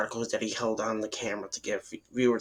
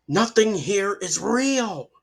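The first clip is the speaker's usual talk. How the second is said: louder and higher, dragged out slowly; dramatic slow realization; 8 semitones higher, 6 dB louder, slower than usual. A narrator's reading. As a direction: let the horror land on each word. Brad's dawning horror in the grove.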